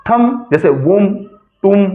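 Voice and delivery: "थंब", "वुम", "तुम" each ending on m; "थंब जैसे वुम, तुम" with drawn-out final m; silent b examples. Shown as a demonstration. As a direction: model थंब, वुम, तुम slowly, b dropped. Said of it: "वो silent रहता है".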